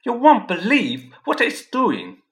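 high, loud, eager calling out; excited call. A narrator's reading. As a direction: shout for them to come look. Pierce excitedly calling family over.

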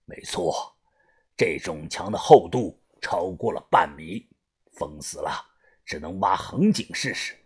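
put-on low, hushed older-hand voice; gang leader, grave. A narrator's reading. Gang leader sizing up the thick wall, grave and hushed. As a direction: answer very low and soft, almost under the breath.

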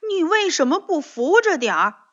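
high, loud blaming question; reproachful. Acted. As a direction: scold the friend for not holding it. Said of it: "责怪地看着蹦蹦说". (责怪地质问)

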